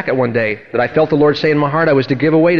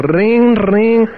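higher ringing piano imitation; imitated piano; 6 semitones higher, 3 dB louder than usual. Preacher mimicking the lady at the piano, imitated ringing. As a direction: mimic jangling piano notes.

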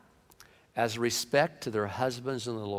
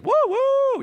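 very high, loud excited whoo-whoo; a man catching sight of a woman and whooping; delighted whoop; 7 dB louder than usual. Acted.

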